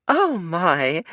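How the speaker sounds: high, sing-song, put-on sweetness; fake sing-songy voice. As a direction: coo it in a false girly voice. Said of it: "she said in a fake, high, sing-songy voice".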